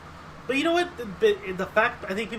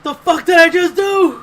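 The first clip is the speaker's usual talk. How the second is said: loud, slow, higher disbelieving outburst; stunned yell; 10 semitones higher, 12 dB louder, slower than usual. Retold or imitated. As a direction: bellow it as if horrified at your own choice. Retelling [name]'s phone hang-up shock.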